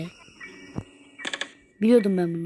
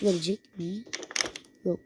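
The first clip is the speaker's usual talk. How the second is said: soft hushed whisper; 4 semitones lower, 8 dB quieter than usual. Real, not acted. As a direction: breathe it while hiding. Hiding, whispering.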